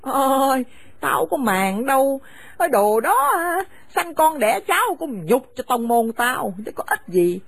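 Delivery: harsh, contemptuous scolding of a daughter-in-law; bitter older woman driving her son's wife from the house; angry scolding mother. Acted. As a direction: spit the insults at her and order her out. Mother orders them out.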